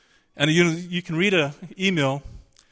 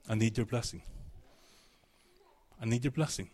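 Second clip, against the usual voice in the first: softer, lower begging; weeping plea; 5 semitones lower, 8 dB quieter than usual. Shown as a demonstration. Jacob begging for the blessing, soft and tearful. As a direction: beg quietly as if near tears. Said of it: "He wept, he begged".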